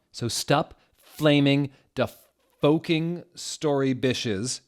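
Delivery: slow, drawn-out mock rant; mock angry author. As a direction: scold the haters in a hammed-up whine. Reading the author's angry note.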